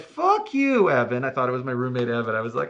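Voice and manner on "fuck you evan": loud, high mock indignation; retold outburst. Retelling his answer to the roommate's supposed prank, loud mock outrage. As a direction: yell it at a prankster, hammed up.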